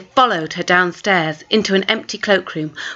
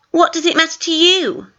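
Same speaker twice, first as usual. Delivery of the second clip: put-on suspicious girl; 9 semitones higher than usual. Twin pressing Maya.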